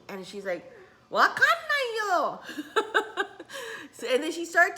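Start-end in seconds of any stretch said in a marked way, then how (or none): 1.1-2.4 much higher and louder, a mock bewildered mum; mom's reply. Quoting mom who can't read the scroll, high and loud. 2.4-3.9 high laugh. Real, not acted. Laughs at own mom impression.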